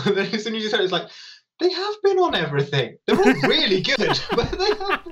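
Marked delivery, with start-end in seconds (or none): 1.6-5.1 high pitched, laughing as he talks. Real, not acted. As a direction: giggle through the words. Laughs at friend's remark.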